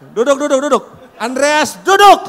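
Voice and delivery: high, very loud command; playful full-voice order to sit; shouted order. Pretend. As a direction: shout across the hall at a friend. Calling friend to sit down.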